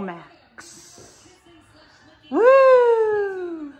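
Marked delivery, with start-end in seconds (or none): 2.3-3.7 high, loud whoop; excited cheer. Real, not acted. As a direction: cheer like the ball just dropped. New Year's Eve cheering.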